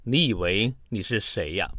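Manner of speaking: ordinary talk, nothing marked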